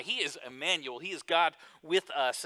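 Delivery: usual speaking voice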